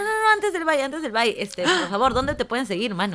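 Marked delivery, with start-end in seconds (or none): 0.0-1.3 high, loud urgent stop; urgent interruption. Real, not acted. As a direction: jump in before the goodbye.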